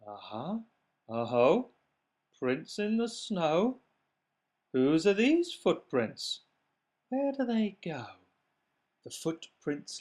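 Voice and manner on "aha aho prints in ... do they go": mouse mocking. Mouse mocks child's words.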